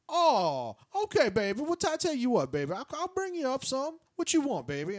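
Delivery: high, loud, doting voice; imitating the room service lady. Retelling the hotel lady offering breakfast, high and doting. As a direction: coo at him like a fussy auntie. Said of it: "This woman definitely sounds like she's Korean".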